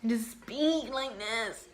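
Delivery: slow, lightly put-on comic voice; Miranda. Shown as a demonstration. Showing her silly habit voice, Miranda-style. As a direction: stretch the words out in a half-hearted impression. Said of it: "it's kind of like that Miranda voice".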